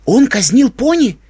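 high, slow incredulous question; loud, stunned and high-pitched; shocked disbelief. A narrator's reading. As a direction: stretch the question out, aghast. Shocked reaction to executions.

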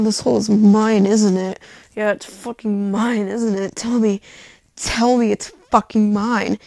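loud, forceful dirty talk, imitated; dominant lover. Man commanding his partner in bed, loud and forceful. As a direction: push it out hard as the demanding partner. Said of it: "he delivers, and he's just like".

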